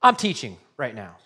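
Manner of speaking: mock rebuke. Preacher imagining Jesus scolding Martha, mock sharp rebuke. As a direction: snap at her, half in jest. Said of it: "blasting Martha for her rudeness".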